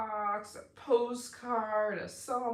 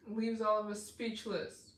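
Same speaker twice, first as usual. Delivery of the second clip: usual speaking voice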